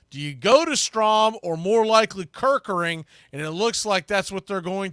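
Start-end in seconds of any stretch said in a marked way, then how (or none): none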